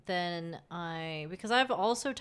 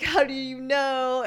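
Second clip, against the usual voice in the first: high, loud mock shock; acted-out alarm, raised voice; alarmed disbelief; 7 semitones higher, 6 dB louder than usual. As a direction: retell it as a startled, higher-pitched demand. Acts out shocked reply to boyfriend.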